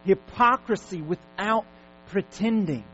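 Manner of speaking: loud, high, drawn-out exhortation; forceful preaching. Acted. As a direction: hammer each command at the congregation.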